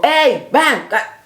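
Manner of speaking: rough beckoning yell; mock call out. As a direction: call him over roughly. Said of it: "No vi que le dijo".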